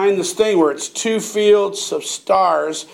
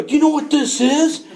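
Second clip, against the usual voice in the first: excited tease; 9 semitones higher than usual. Retold or imitated. Showing friends the plant.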